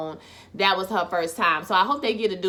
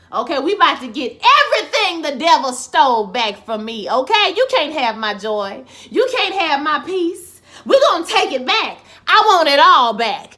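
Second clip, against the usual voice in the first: church-style cheering; 3 semitones higher, 5 dB louder than usual. Real, not acted. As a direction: testify like the gospel is moving you, getting louder. Cheering as gospel song starts.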